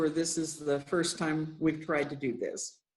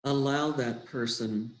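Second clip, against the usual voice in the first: guided meditation; 2 semitones lower than usual. Acted. Leader guiding listeners into breathing and love, unhurried meditation voice. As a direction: guide them gently inward, phrase by unhurried phrase.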